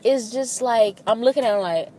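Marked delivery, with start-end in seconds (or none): none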